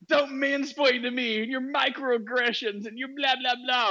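high, drawn-out mocking caricature; mock outraged rant. Host mimics the atheist who kept going, mock outraged. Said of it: "and she kept going".

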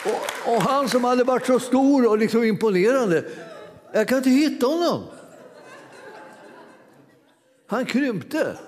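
high, laughter breaking through the words; can barely keep from laughing at the story; laughing while telling. Real, not acted. Preacher to congregation, retelling the devil's fall, laughing high.

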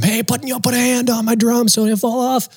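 high, hammed-up mock yell. Drummer mock-yelling at stagehand pushing his riser. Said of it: "I just keep screaming at him".

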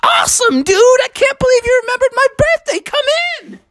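high, excited welcome; gushing Froggy voice; thrilled frog host. Acted. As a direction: greet a friend with amazed delight.

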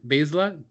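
Bielsa said BAYZ-luh; mispronounced surname. Real, not acted. Host trying another guess at the surname, mispronounced Bielsa as BAYZ-luh. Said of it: "have a hard time saying his last name".

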